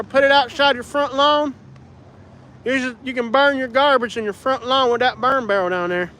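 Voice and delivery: high-pitched Appalachian hillbilly twang; hillbilly accent. Acted. Hillbilly explaining front-lawn garbage burning, high twangy accent. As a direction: sell it in a broad Alabama backwoods accent. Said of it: "The hillbilly accent is hilarious".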